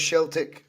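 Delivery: "Celtic" said with an opening sh; sh sound starting "Celtic"; Sheltic pronunciation. Real, not acted. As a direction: say "Celtic" as "Shell-tick". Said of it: "that's not me doing the Dutch accent".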